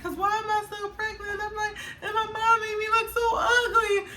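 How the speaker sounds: very high, wailing complaint; mock crying whine. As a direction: whine it high, imitating a tearful pregnant meltdown. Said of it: "he started laughing 'cause I'm crying".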